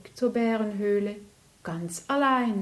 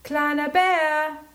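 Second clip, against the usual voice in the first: loud, slow, drawn-out call; 7 semitones higher, 6 dB louder than usual. Acted. Big bear calling from the cave, loud drawn call. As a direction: call across the rocks, stretching it out. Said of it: "rief der große Bär".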